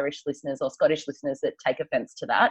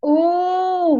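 excited ooh; 12 semitones higher, 4 dB louder than usual. Real, not acted. Excited reaction.

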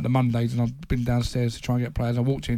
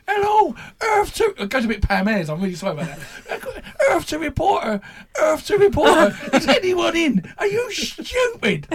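much higher, loud, mocking impression of a ranting manager; squeaky, hammed-up meltdown with laughs; a furious manager mocking a reporter, high and loud; 6 dB louder than usual. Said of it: "my impression may not be good".

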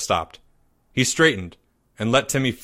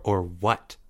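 cool challenge; 4 semitones lower, 5 dB quieter than usual. A narrator's reading. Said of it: "Maya interrupted".

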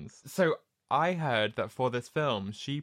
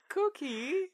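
very high sugary cookie offer; sweet high offer. Retold or imitated. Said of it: "she offers the fortune cookies".

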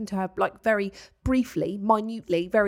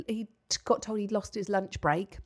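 ordinary talk

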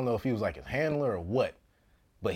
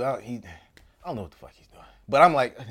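ordinary talk, nothing marked

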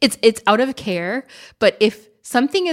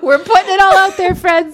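laughing; 10 semitones higher, 6 dB louder than usual. Real, not acted. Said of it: "laughing announcement".

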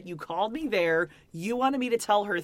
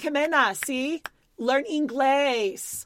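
higher and slow, snapping at Jimena; 5 semitones higher, slower than usual. Retold or imitated. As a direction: bite out Learn English slowly. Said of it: "she, like, snapped".

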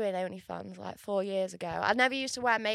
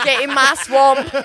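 Shrek voice; 14 dB louder, slower than usual. Pretend. Shrek quote, joking about roles.

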